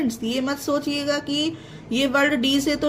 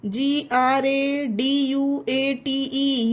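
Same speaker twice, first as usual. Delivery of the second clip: normal talking voice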